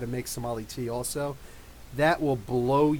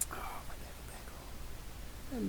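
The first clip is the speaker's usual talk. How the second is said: slow, very soft whisper; doting whisper; 8 semitones higher, 14 dB quieter, much slower than usual. Real, not acted. Doting on pet Bagel, slow whisper. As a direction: whisper tenderly, drawing it out.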